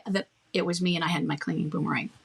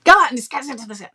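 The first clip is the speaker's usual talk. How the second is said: louder and fast; rude; 5 semitones higher, 6 dB louder, much faster than usual. Shown as a demonstration. Wrong way to shut down someone pushing accusations, louder and rude. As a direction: snap it out dismissively. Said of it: "not rude".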